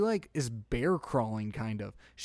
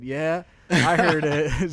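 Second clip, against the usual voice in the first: drawn-out, louder yes I heard it; slow emphatic confirmation; 3 semitones higher, 9 dB louder, much slower than usual. Retold or imitated. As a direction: stretch it out slowly with emphasis. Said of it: "I said".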